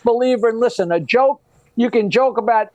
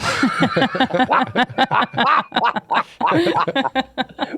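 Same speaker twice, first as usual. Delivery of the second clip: laughing at own joke; 3 semitones higher than usual. Real, not acted.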